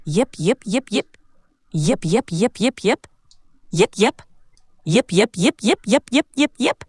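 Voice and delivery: little rising puppy yips in quick clusters; super cute puppy yips. Acted. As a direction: yip sweetly like a tiny pup. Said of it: "Let's imagine it's super cute this time".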